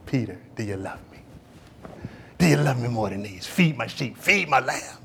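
lightly voiced, low and hushed; Jesus. Preacher voicing Jesus questioning Peter, soft and low. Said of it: "Then Jesus showed up".

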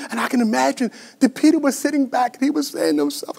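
high, strained, emotional sermon voice; pained, pleading storytelling; anguished preaching. Real, not acted. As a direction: preach his hurt as if near tears. Preaches Peter's grief.

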